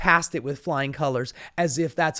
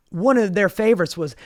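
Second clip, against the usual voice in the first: usual speaking voice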